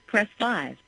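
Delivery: ordinary talk, nothing marked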